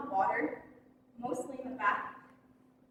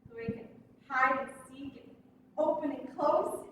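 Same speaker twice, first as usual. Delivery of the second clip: ordinary talk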